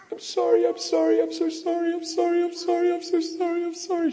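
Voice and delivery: very high-pitched, frantic imitated sobbing; crying uncontrollably. Wife running to her husband to apologize, imitated frantic crying. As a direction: plead through a high, retold crying fit. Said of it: "She's crying out of control".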